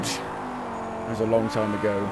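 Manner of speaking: put-on slow, low grumbly old-timer; old man voice. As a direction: sigh it like a grandad. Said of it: "I sound like an old man".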